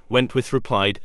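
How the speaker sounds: usual speaking voice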